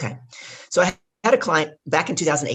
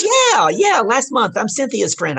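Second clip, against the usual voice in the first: excited reply; 9 semitones higher, 6 dB louder, slower than usual. Retold or imitated. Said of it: "She goes".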